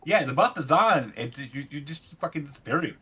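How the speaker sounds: usual speaking voice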